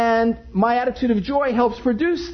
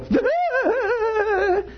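startled shriek. Retold or imitated. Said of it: "and I go".

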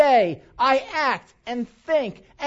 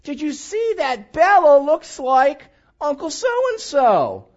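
excited relative; 4 semitones higher, 6 dB louder, much slower than usual. Retold or imitated. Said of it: "goes like this".

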